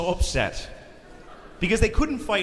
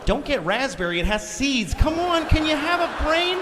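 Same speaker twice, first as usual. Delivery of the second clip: high-pitched mock nagging, climbing higher; hammed-up fed-up scolding; exasperated wife; 12 semitones higher than usual. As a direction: scold him, rising to a screechy peak. Acted nagging wife berating husband.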